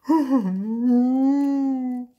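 long, high, loud ghost wail; hammed-up crying "huhuu"; howling sob. A narrator's reading. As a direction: burst out bawling. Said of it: "Heulte Bibi auf".

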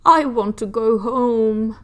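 slow, whiny plea; feeling sick and sorry; sad. Acted. Sick full Hetty pleads.

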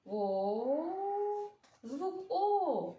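high, stretched О; showing the vowel. Shown as a demonstration. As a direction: sing out the vowel О. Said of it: "Який голосний звук".

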